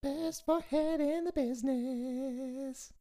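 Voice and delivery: high slow drawn-out mock voice. Repeating Kyle's quote.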